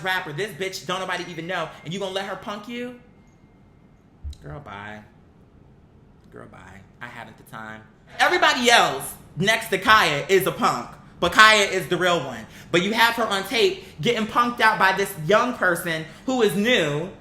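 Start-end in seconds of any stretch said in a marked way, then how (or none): none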